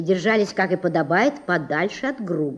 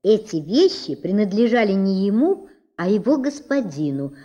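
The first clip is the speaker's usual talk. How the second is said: ordinary delivery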